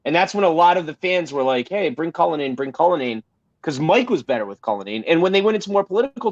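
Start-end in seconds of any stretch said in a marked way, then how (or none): none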